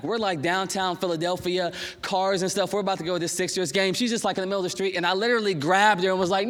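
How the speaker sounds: ordinary delivery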